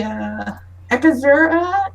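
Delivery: ordinary talk, nothing marked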